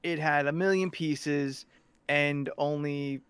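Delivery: ordinary talk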